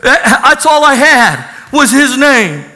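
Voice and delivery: loud, high testimony preaching; a preacher testifying to his congregation in full voice; preaching at full force. Acted. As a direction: preach it, hallelujah rising. Testimony climax preached loudly.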